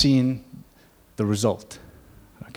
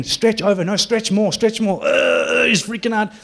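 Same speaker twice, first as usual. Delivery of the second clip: high, loud, insistent mock of the healers; mocking pushy healer; 7 semitones higher, 4 dB louder, slower than usual. Mocking the healers who pushed his dad around, high and insistent. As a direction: ham up a bossy minister ordering the stretch.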